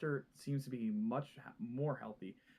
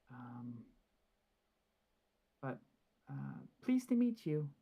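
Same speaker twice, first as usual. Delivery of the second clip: gentle matron, pitched up; motherly matron voice; 3 semitones higher than usual. Acted. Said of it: "almost, like, motherly matron type voice".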